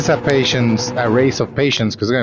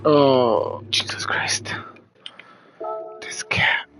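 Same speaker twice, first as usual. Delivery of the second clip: softer, unvoiced, under the breath; exasperated whisper; 3 semitones higher, 5 dB quieter, slower than usual. Real, not acted. Streamer whispering in exasperation about his cat.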